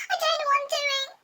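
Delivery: high panicky blurt; blurted confession. Retold or imitated. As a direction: squeak it out in panic. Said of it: "decides to just blurt out".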